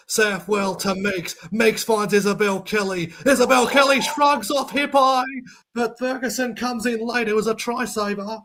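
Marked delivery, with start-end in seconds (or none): 3.3-5.2 higher, louder burst; excited call. Real, not acted. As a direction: rise with the break. Live call of broken tackle.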